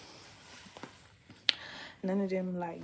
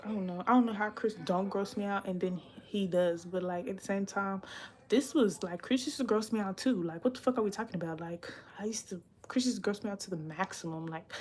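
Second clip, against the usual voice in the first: normal talking voice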